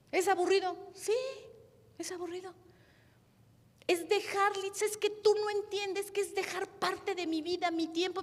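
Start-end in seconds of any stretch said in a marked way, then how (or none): 3.9-8.2 pleading complaint. Retold or imitated. Imita a una servidora que reclama.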